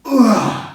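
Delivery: loud high groan of disgust; disgusted ugh. Retold or imitated. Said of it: "And I'm like".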